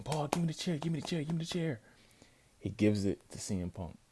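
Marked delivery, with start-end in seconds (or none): 0.0-1.8 high, fast begging for the chair; imitating wrestlers pleading for the chair; mock pleading. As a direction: beg over and over, pitched up. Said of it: "They're both pleading".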